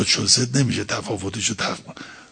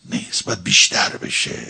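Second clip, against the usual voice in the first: ordinary talk, nothing marked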